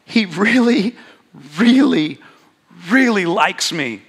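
"really" stretched, high and ringing; stress on really. Real, not acted. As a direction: lean hard on every "really". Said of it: "repeated "really" for emphasis".

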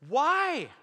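very loud, high "why?"; incredulous outburst. Retold or imitated. Uncle baffled that kids were scared, loud disbelief. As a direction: wail it in mock dismay. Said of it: "He's like".

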